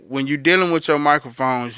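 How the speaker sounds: normal talking voice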